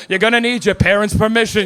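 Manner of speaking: put-on British host, solemn instruction; British accent. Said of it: "a show with a British guy".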